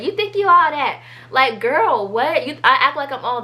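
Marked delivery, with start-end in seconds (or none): 0.0-1.0 higher-pitched, lightly voiced little kid. Mocking a little kid's taunt in a retold argument, lightly childlike. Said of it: "that's what the little kid said".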